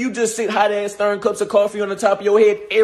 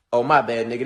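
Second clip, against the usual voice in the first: ordinary delivery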